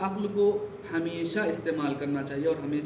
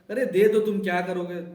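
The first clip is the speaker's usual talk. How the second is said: louder, higher urging in a retold quote; insistent pleading; 4 semitones higher, 7 dB louder than usual. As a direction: act out pressing someone to hand it over. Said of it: "انہوں نے بہت اصرار کیا". (اصرار بھرے لہجے میں، اونچی آواز سے)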